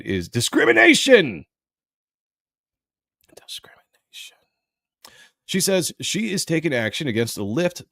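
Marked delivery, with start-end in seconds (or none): 3.5-4.4 breathy whisper of the word; whispered echo. Pretend. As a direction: mutter it back under your breath. Repeating word mockingly under breath.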